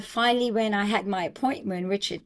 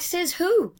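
defiant retort; 9 semitones higher, 3 dB louder than usual. Retold or imitated.

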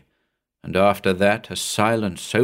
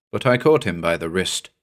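ordinary delivery

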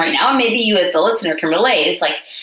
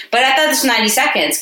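ordinary talk, nothing marked

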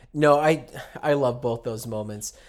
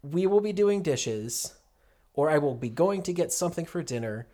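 normal talking voice

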